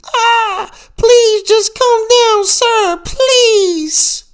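shrieking high and loud, pleading; panicked scream. A narrator's reading. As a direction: scream it in terror, begging. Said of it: "another lady hipster screamed".

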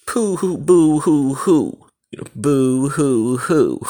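mocking boo hoo sobs; sarcastic mock weeping; fake crying. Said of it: "Now you say, "Oh, well".

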